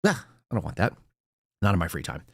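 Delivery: low, muted mock disgust; mildly disgusted dismissal. Radio listener switching off the host, low mildly disgusted dismissal. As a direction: wave it away with a quiet "ugh". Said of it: "turn it off and go".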